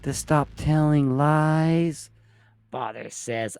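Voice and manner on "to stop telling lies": put-on higher, small child's answers; a scolded boy reciting his lesson; boy's voice. Peter answers his father.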